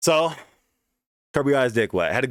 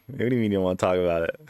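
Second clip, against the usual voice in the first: fast insistent; 6 semitones lower, much faster than usual. Retold or imitated. Pushing friend for the story.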